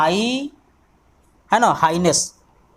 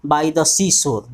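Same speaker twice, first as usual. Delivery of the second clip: slow dictation; 3 semitones higher, much slower than usual. Shown as a demonstration. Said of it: "ध्यान देना है".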